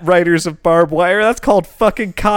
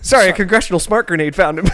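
normal talking voice

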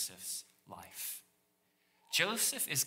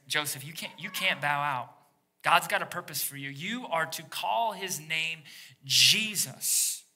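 louder, insistent angel; firm angel; 3 semitones higher, 5 dB louder than usual. Retold or imitated. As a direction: press Joseph not to back out.

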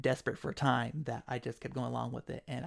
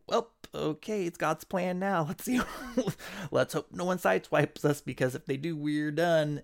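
higher, half-laughing resignation; resigned joking; 4 semitones higher, 4 dB louder than usual. Retold or imitated. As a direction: shrug it off with a nervous laugh. Couple resigned in car, laughing.

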